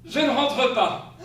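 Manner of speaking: loud, slow final answer; firm husband. Acted. Husband telling wife he won't come back, firm and slow. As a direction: lay it down flatly and slowly.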